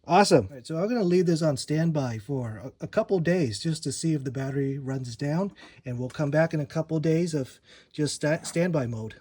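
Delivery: ordinary delivery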